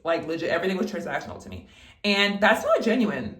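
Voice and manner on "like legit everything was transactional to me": usual speaking voice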